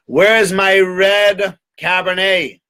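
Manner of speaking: high, drawn-out demand; loud, slow ducal demand; demanding duke. Acted. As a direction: draw out each word imperiously. Duke demanding wine in story.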